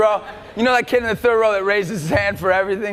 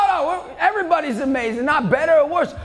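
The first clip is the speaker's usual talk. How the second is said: usual speaking voice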